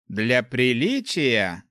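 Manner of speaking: slow, drawn-out musing echo; pondering question to oneself; thoughtful. A narrator's reading. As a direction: draw the words out as you mull them over. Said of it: "задумчиво произнёс Руди".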